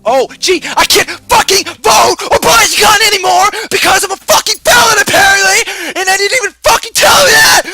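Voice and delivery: furious, very high-pitched shouting; screaming rant. Acted. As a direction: scream your outrage at full force. Furious rant about felony consequences.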